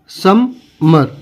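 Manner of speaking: "समर" very slow, sum... mer; broken into syllables. Shown as a demonstration. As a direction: pause in the middle of "समर". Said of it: "तो इसको क्या पढ़ेंगे?".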